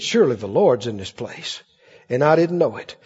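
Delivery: low, soft wondering Jacob; a hint of awed Jacob; hushed awe. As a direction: breathe it like a waking man amazed.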